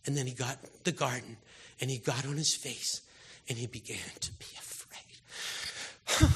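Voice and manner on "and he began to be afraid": slow breathy whisper; hushed whisper of Jesus' fear. Real, not acted. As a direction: breathe it out slowly, barely voiced.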